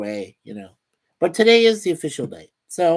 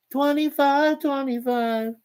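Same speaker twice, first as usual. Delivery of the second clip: high, slow, sing-song repeat; cutesy echo; 10 semitones higher, slower than usual. Shown as a demonstration. Host echoing a viewer's cute date, high and slow. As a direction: coo the date back like it's adorable.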